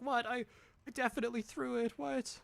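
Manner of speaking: high, quick, baffled protest; hammed-up high-pitched confusion; mock disbelief. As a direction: imitate an incredulous player insisting on their throw. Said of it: "And person's like".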